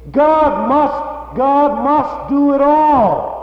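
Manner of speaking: high, loud, slowed mimicry of a false teaching; mocking quote of antinomians. Preacher to congregation, quoting the antinomians: high, loud mockery. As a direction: parody their slogan with scorn, stretching the words out. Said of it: "they say".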